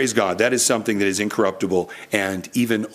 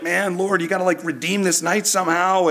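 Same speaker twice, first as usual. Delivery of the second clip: higher, pleading appeal; retold earnest plea, pitch raised; last-ditch prayer; 8 semitones higher than usual. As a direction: urgently ask God to rescue the night. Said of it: "I was like".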